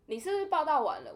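imitating a harsh officer; harsh scolding. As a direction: scold the late student. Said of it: "他就这么凶哦".

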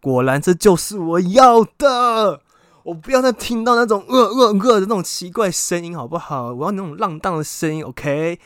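loud hammed-up triumph; triumphant excited. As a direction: crow with delight. Retold triumphant exclamation.

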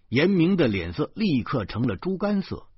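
plain reading voice

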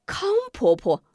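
high, glad call of recognition; startled and delighted to see her; 惊喜地喊; surprised cry. Acted. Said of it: "惊道".

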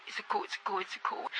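breathless whispered tearful words; barely voiced, close to tears; tearful whisper recalling crying alone; choked whisper. Real, not acted.

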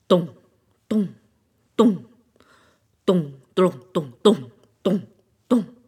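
low, loud booming dong dong; the drum showing its sound, low booming beats. A narrator's reading. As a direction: beat it out like a drum.

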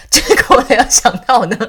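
can't stop laughing as you tell it. Real, not acted. Laughing while telling funny story. (边笑边说)

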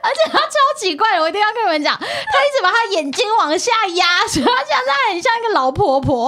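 high, laughing and loud; giggling while teasing. Real, not acted. Laughing while describing partner. (边笑边说)

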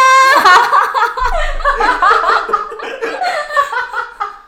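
long, high, falling real laugh. Real, not acted. Laughing at her own grandpa story. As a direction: dissolve into laughter.

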